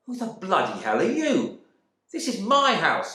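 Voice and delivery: loud, indignant retort; angry husband. Acted. Husband shouting at the stranger in his house, indignant. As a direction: stress my house, claim it back.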